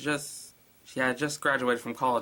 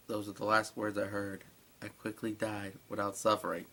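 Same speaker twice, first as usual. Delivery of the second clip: ordinary delivery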